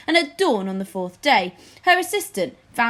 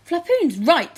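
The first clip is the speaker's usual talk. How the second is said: flattering courtier; 7 semitones higher, 6 dB louder than usual. Acted. Courtier Spittleworth agrees.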